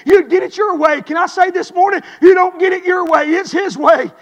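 loud, driving sermon build; fiery preaching. Acted. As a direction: preach it hard to the congregation. Preaching builds to full force.

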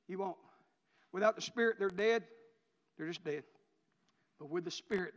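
ordinary talk, nothing marked

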